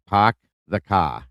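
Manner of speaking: put-on slow Boston accent, 'Park the car' as pahk the cah. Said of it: "I love listening to their accent".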